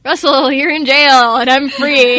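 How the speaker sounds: hammed-up Quinn. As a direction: overplay the worried rescuer. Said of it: "now it's kinda like".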